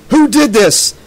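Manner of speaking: high, loud accusing shout; furious demand. A narrator's reading. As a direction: demand it like a crazed, grieving father. Crazed father confronts the teenagers.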